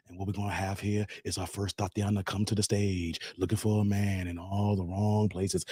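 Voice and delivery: low, smooth poetry hour emcee; Pookie the Poet. Pretend. Poet host introducing Tatiana on jazz hour, smooth poet voice. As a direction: croon it like a spoken-word host. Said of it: "Pookie the Poet voice".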